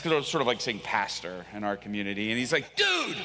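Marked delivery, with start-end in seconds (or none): 2.7-3.2 urgent call. Retold or imitated. Pastor repeats the alarm.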